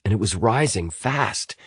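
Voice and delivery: ordinary delivery, nothing marked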